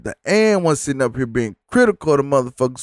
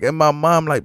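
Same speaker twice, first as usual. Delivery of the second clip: ordinary talk, nothing marked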